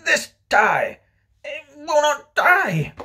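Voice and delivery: high, loud, drawn-out outburst; furious yell. Acted. Father rages at his tie, really yelling. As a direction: yell it slowly, at the end of your rope. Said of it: "He really yelled".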